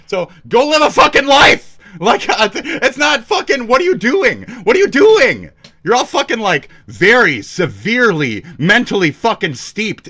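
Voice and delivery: high, loud, exasperated shouting; angry yelling. Real, not acted. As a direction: yell at them to go get a life. Angry rant at online rivals.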